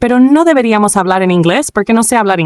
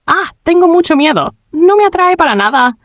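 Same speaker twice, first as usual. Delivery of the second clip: scared; 9 semitones higher than usual. Retold or imitated. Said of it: "le daban miedo los juegos de terror".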